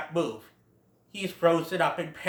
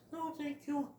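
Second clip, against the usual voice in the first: higher, much softer small voice; polite little Bambi; 9 semitones higher, 8 dB quieter than usual. Retold or imitated. Bambi declining Feline's offer, small voice. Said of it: "But Bambi says".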